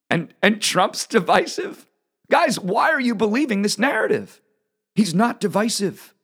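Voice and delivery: as if the charge is absurd; incredulous. Real, not acted. Mocking the divisive narrative.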